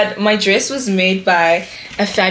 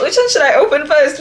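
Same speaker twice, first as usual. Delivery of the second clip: usual speaking voice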